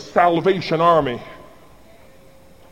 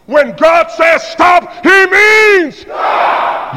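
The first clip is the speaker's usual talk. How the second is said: slow, loud and much higher, stretched; full shout; 10 semitones higher, 5 dB louder, much slower than usual. Real, not acted. Preacher at full force to the congregation, shouting. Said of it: "I think we're together now".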